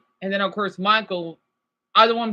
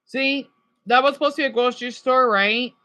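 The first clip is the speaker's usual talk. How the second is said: normal talking voice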